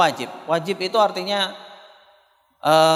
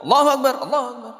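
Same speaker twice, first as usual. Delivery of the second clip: pecking-fast prayer; 7 semitones higher, faster than usual. Shown as a demonstration. Teacher mimicking too-quick prostrations, high hurried takbirs. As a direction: hurry through them like a pecking chicken. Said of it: "Kayak ayam ya".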